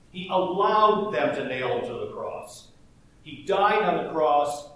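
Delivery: normal talking voice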